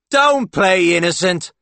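high, slow, loud accusation; hammed-up jealous exclamation. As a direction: drag out the accusation. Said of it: "Paul exclaims".

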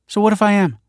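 a lightly voiced bold comeback; defiant retort. A narrator's reading. Young woman defending her outlook, defiant.

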